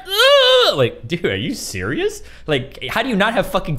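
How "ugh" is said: disgusted ugh. Real, not acted. Disgusted outburst.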